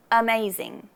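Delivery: "amazing" with a short relaxed first uh; "amazing" opening on a lazy schwa. Shown as a demonstration. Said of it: "a bit longer than uh".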